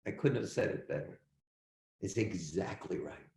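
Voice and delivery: moved, praising a student quietly; teacher quietly moved by a student's answer; getting emotional. Real, not acted. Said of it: "I'm getting so emotional about this".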